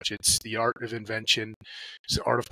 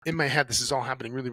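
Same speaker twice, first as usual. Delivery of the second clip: normal talking voice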